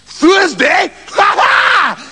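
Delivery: high, loud delighted laughing. Acted. Trousers realises it's Thursday, loud laughing. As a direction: burst out laughing in triumph. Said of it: "Trousers began to laugh loudly".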